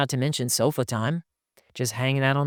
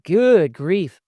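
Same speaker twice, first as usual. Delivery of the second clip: loud, high mock exasperation; hammed-up dismay, louder and higher; exasperated guys; 6 semitones higher, 8 dB louder than usual. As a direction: groan it out as an overwhelmed husband. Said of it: "to a lot of guys this sounds like".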